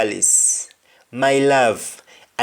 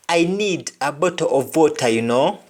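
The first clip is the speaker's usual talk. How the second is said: normal talking voice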